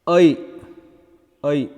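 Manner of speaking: I as a gliding vowel, first one loud; isolated, emphatic glide on "I"; diphthong I. Shown as a demonstration. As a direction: sound out I alone, then repeat. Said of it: "this is pronounced".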